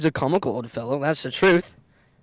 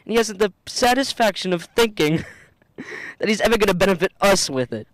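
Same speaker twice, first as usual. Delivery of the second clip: higher and louder with a laugh; laughing along; 6 semitones higher, 4 dB louder than usual. Acted. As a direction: chuckle through it. Fred laughing about uncle.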